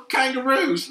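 high, loud, drawn-out Australian shout through laughter; mock Australian. Friend joking about Australia, mock Australian accent. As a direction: stretch the word out in cartoon Australian. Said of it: "some shitty Australian accent".

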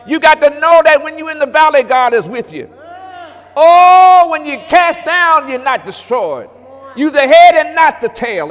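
loud, high, fast preaching; preaching at full force. Acted. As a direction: shout the promise from the pulpit.

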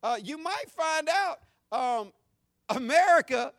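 high, loud, fervent preacher at full force; fiery preaching. Acted. Pastor scolding critics of America, preaching at full force. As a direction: thunder it from the pulpit like a challenge.

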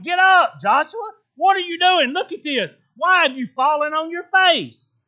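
God scolding Joshua to get up, loud; God's stern rebuke. Retold or imitated. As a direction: rebuke him like an impatient father. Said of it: "And the Lord said to Joshua".

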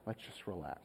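very soft and low; quiet, low-key retold reassurance; calming daughter. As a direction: imitate a hushed word to settle someone.

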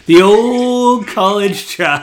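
slow, high and loud, a big mock rally cry; hammed-up cheer. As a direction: drag it out like a cheesy pep cheer. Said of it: "GM cheers them on".

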